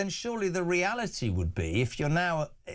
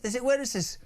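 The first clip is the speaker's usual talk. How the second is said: ordinary delivery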